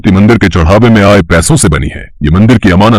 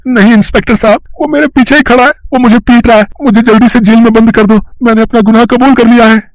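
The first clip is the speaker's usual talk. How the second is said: much higher, urgent terrified voice; frantic pleading; 14 semitones higher than usual. Acted. Thief begging the inspector to jail him, frantic high pleading. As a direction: plead to be locked up for safety.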